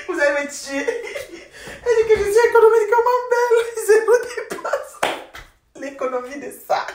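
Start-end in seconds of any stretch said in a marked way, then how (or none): 0.0-4.9 high, squealing laugh-talk; almost crying with laughter. Real, not acted. Repeating the viewer's joke to her audience, in fits of laughter.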